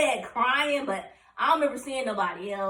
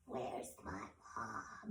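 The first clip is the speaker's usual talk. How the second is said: very slow, low, barely audible croak; imitated feeble patient; weak voice; 13 semitones lower, 21 dB quieter, much slower than usual. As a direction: strain out each word with almost no voice. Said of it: "that's how I sounded".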